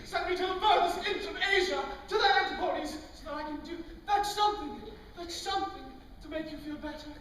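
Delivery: a hint of overwrought courtly devotion; doting suitor. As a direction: fuss over her like a lovestruck knight.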